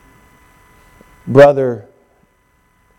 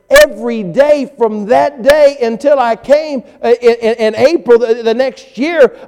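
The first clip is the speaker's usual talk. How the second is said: building preaching; 11 semitones higher, 5 dB louder than usual. Acted. Pastor preaching to congregation, building to full force. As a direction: lift it up into a preaching climax.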